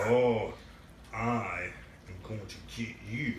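low, slow threat from a toy dinosaur; lightly voiced low monster; menacing dinosaur.

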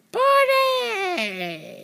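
high shrieked party cheer; excited shriek. Pretend. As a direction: squeal the word out in glee. Kid shouting party cheer.

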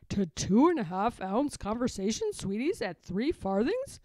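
a bobby squinting at a paper; suspicious policeman. Acted.

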